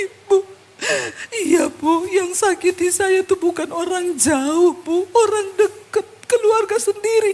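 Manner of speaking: high, slow, drawn-out mock sobbing; imitated crying. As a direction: wail it out like a weeping woman.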